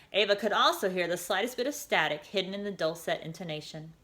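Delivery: usual speaking voice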